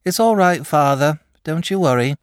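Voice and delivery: put-on high boy's voice, mildly drowsy; sleepy reassurance. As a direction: reassure father with a touch of sleepiness. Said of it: "said sleepily".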